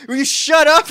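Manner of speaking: loud, high angry demand; angry shout. Retold or imitated. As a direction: snap at the heckler from the stage. Telling off heckler.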